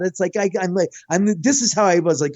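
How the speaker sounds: usual speaking voice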